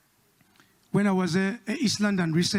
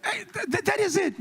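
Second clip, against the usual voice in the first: exasperated; 12 semitones higher than usual. Real, not acted. Exasperated outburst.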